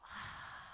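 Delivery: slow audible exhale; soft sighing out-breath, the name of God; long breath out. Shown as a demonstration. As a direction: breathe out slowly so it can be heard. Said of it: "You hear that sound?".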